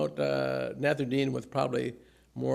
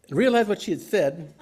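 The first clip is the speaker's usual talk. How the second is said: holy laughter; 5 semitones higher, 7 dB louder than usual. Real, not acted. Laughing at the punchline.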